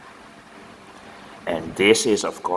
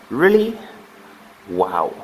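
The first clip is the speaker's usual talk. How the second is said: surprised. Real, not acted. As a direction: sound truly amazed at the answer. Teacher reacts to child's answer.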